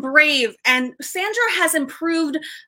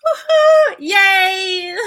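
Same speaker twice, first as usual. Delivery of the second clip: high, loud celebratory whoop; excited cheer; 6 semitones higher, 5 dB louder, much slower than usual. Real, not acted. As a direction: cheer for the winner with a big high woohoo. Celebrating award winner on stream.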